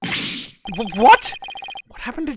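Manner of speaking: sudden high squeak of disbelief; shocked. Acted. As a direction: blurt it out in shock. Shocked at friend's death penalty.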